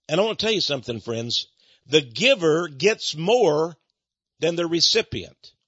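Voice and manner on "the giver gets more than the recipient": slow, weighty preacher's emphasis; slow, deliberate pulpit declaration; emphatic maxim. Real, not acted. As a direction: drive each word home slowly. Preacher stresses his key maxim.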